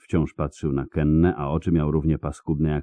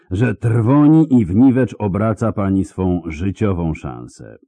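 higher, louder threat to a rebel; cold, raised scolding; menacing rebuke; 5 semitones higher, 6 dB louder than usual. Acted. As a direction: lash her with the consequences.

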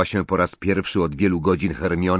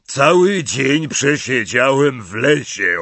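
a hint of a big, out-of-breath giant, louder and slower; panting Hagrid; 5 semitones higher, 5 dB louder, slower than usual. A narrator's reading. Hagrid arriving breathless from the forest, lightly voiced, louder. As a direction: puff the words out like a soaked giant back from the forest. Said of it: "dysząc ciężko".

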